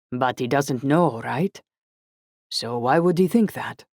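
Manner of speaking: put-on puzzled, Italian-accented questioning; lightly voiced Italian friend, puzzled; rich Italian accent. A narrator's reading. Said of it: "his rich Italian accent".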